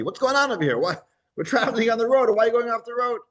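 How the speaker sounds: a rider barking at his balking donkey; Bilam scolding. Acted.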